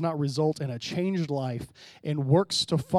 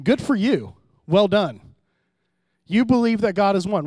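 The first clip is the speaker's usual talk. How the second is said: mocking "Good for you", a touch louder; sarcastic; 4 semitones higher, 7 dB louder than usual. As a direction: pat them on the head with a sneer. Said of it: "that's a sarcastic statement".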